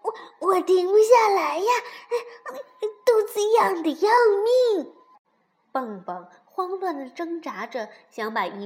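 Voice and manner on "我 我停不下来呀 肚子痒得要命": high, loud helpless squeal; shouting, ticklish. A narrator's reading. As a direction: yell through unbearable tickling. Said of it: "蹦蹦却大叫道". (痒得受不了地高声叫)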